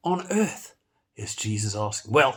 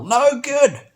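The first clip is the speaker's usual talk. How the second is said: No good, much higher and louder; emphatic; 9 semitones higher, 8 dB louder than usual. Acted. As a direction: hammer the verdict home. Emphatic verdict on losing soul.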